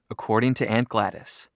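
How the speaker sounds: plain reading voice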